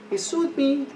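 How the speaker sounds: "He sued me" pitched high in disbelief. Real, not acted.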